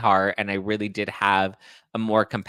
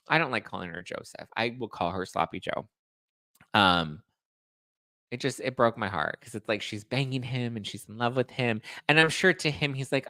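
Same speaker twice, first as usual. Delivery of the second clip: ordinary talk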